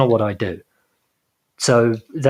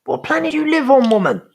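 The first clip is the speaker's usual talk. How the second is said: high, louder-pitched exasperation; incredulous rebuke; 13 semitones higher, 4 dB louder than usual. Acted. Co-host scolding the absent woman in her bubble, incredulous.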